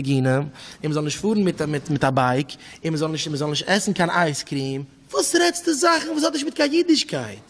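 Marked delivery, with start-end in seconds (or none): 5.1-7.4 high, challenging rhetorical question; indignant retort. Retold or imitated. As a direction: throw it back high, in protest. Acted retort to talk of rules.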